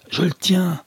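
high excited shout of capture; triumphant cry. A narrator's reading. Captain grappling the invisible man, shouting triumphantly. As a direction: yell out that he has caught him. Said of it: "crie le capitaine Harlan".

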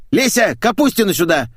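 high, forceful boss's bellow; thundering order. Acted. Boss summoning staff through the intercom, thundering. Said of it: "загремел он".

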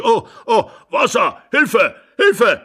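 put-on high, loud alarmed old man; panicked cry for help. A narrator's reading. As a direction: shout for water and help in alarm.

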